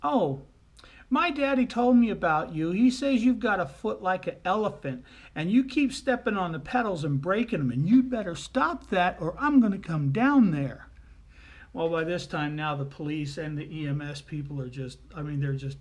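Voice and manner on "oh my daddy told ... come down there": put-on loud, high, singsong child scolding; little girl. Little girl warns the lady on the radio, scolding loudly. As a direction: lay down the law like an indignant little girl. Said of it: "To which the little girl replies".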